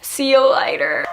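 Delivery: imagined cheery goodbye leaving work, high and drawn out; breezy goodbye. Retold or imitated. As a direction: sing it out like you're off early. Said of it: "I was gonna be like".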